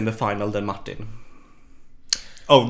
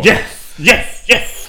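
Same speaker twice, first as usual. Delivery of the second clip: excited yes; 9 semitones higher, 7 dB louder, much slower than usual. Real, not acted. Excited agreement about the finalist.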